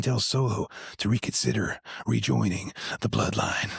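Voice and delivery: lightly imitated low, intense speaking voice; a hint of a low, intense wrestler; Jacob Fatu. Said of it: "He's got that very low, speaking, intense voice".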